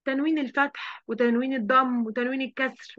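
ordinary talk

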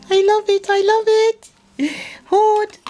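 high, delighted squeal; excited gushing. Real, not acted. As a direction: gush with delight over a present. Gushing over a gift.

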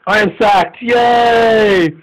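high, loud celebratory shout; gleeful cheer. Real, not acted. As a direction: whoop at being sacked. Learns he is sacked, cheers.

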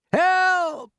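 very high, loud cry for help; panicked cry. A narrator's reading. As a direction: cry out as a terrified centipede. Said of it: "cried the centipede".

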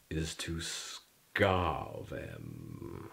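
slow, low and menacing; sinister low slow. Acted. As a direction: drop it low and slow like a threat. Ominous punchline.

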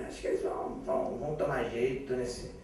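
conspiratorial whisper; whispering old ladies. Acted. Old ladies whispering together, scheming. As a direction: whisper it like plotting biddies.

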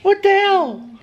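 squeaky high shocked cry; a falsetto squeal of disbelief; mock shock. Real, not acted. Son squealing at his mother's rude word: high mock shock.

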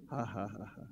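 soft, low faith laugh; quiet mock laughter at the enemy; a demonstration laugh, held back; demonstrated laugh. Shown as a demonstration. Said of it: "Just try to laugh".

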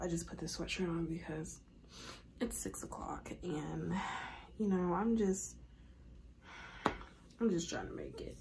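hushed. Real, not acted. Hushed walking to class.

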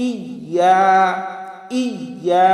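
"ইয়া" with full I, then held YA; sounded hamza. Shown as a demonstration. Teacher modelling the hamza, deliberate doubled-Y recitation. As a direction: lean on the opening I of "ইয়া". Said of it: "হামজার উচ্চারণ করতে হবে".

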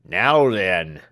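a curt servant's grumble; unceremonious reply. Acted. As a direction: answer like a sour little servant. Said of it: "unceremonious acknowledgment of".

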